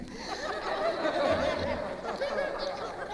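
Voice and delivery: squealing high laugh at punchline. Real, not acted. Comic cracking up at her own teeth joke, squealing laugh.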